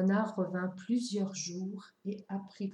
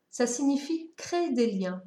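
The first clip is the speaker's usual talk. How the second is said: lightly voiced fox, louder statement; explaining fox; 5 semitones higher, 6 dB louder than usual. A narrator's reading. Fox defines taming for the boy, lightly voiced statement.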